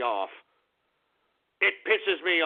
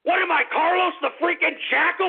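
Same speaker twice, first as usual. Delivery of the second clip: furious shout; 9 semitones higher than usual. Acted. Ranting host yells at listeners about government, furious shout. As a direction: rant at the top of your lungs about being hunted.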